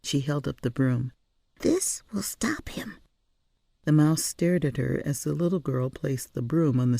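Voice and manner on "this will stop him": put-on high, soft child's whisper; quietly sure, keep it hushed. A narrator's reading. Girl whispering on stairs.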